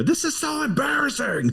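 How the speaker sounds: high, pained mock mortification; Billy Eichner sommelier. Quoting a sitcom sommelier to his co-host, high and pained. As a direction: squeal it like a mortified snob. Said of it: "throw in there".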